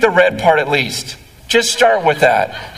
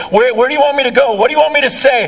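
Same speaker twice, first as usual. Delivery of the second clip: impassioned plea; 7 semitones higher, much faster than usual. Retold or imitated. Pleading surrender to the Lord.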